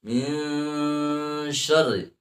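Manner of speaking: "min" very slowly hummed before "syarri"; ikhfa. Shown as a demonstration. Teacher demonstrates ikhfa, long hummed "min". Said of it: "itu dibaca ikhfa".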